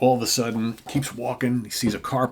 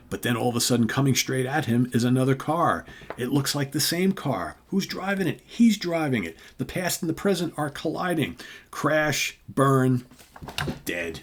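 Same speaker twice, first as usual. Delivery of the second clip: higher, building narration, dropping on the last word; dramatic story climax; 6 semitones higher, 4 dB louder than usual. Acted. Host retells a comic's fatal car crash, dramatic narration. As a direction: build urgently to the crash, then drop.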